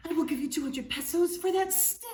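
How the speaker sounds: high, loud eager offer; shocked exclaim. Acted. Fisherman begging Juan for the magic stick, loud and high. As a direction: blurt it out, stunned and greedy. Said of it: "exclaimed the shocked man".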